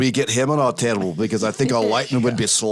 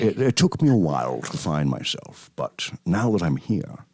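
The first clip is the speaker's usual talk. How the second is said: Sean Connery impression; 3 semitones lower than usual. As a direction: savour each word like Connery. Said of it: "I can do a really good Sean".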